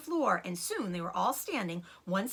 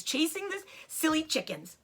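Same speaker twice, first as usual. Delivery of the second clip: ordinary delivery, nothing marked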